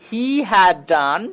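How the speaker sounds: very slow with stress on had; slow model sentence. Shown as a demonstration. Teacher slowly modelling the sentence for students. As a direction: draw out each word clearly. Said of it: "তো এটাকে ইংরেজিতে বলবো".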